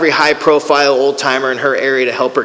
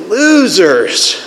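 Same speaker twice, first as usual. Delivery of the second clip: higher, drawn-out mock contempt; acted-out disdainful dismissal; judging scorn; 5 semitones higher, 3 dB louder than usual. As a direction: sneer at them in your head. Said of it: "Though I'm judging".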